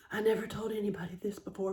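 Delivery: fast, unvoiced secret-telling whisper; quick hushed confession; confiding whisper.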